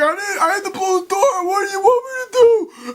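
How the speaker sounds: high, loud whining protest; mock panicked henchman; panicked whine. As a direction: plead desperately with the boss. Henchman pleading with angry boss.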